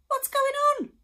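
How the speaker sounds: high, loud cry of alarm. A narrator's reading. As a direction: call out in shocked alarm. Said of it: "he cried".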